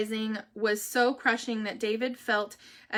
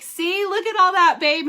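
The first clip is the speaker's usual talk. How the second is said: higher, louder, cheering him on; excited to husband; 8 semitones higher, 10 dB louder than usual. Retold or imitated. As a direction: point it all out to her husband, thrilled. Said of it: "So I was like".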